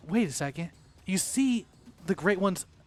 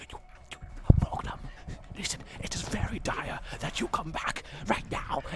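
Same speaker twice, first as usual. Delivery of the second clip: whispered, eerie pumpkin plea; creepy pumpkin; 3 semitones higher, 4 dB quieter than usual. Acted. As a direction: urge in a spooky whisper. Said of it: "it sounds creepy".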